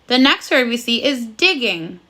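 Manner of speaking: usual speaking voice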